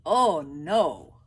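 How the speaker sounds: loud, dismayed exclamation; Albert crying out at the request; Albert protesting. Acted. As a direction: refuse with mock alarm. Albert refusing.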